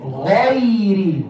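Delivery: غير drawn out slowly with a heavy "ghai"; heavy gha. Shown as a demonstration. Teacher models غير for students, heavy gha. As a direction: hold the full gh and open a of غير. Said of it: "It's gha".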